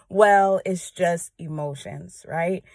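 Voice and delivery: ordinary talk, nothing marked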